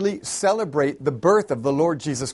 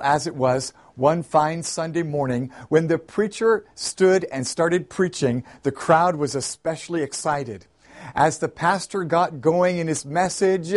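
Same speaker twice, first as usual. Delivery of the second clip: ordinary talk, nothing marked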